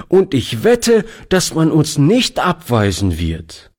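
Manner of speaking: put-on high, quick eager child's plea; a boy begging, words tumbling out; lively boy. A narrator's reading. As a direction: Toni pleads with the lady, high and hurried. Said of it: "sagte Toni lebhaft".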